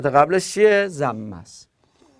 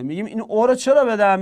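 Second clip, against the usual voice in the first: ordinary talk, nothing marked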